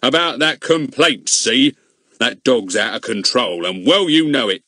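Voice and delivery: a touch of bluster; angry policeman. A narrator's reading. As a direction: policeman threatens about the dog.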